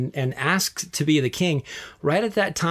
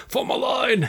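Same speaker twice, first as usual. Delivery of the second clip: a high, put-on quote of the Gladiator line; movie quote; 10 semitones higher than usual. Quoting Gladiator to her cohost, high mock voice.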